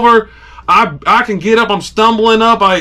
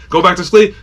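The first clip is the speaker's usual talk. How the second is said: normal talking voice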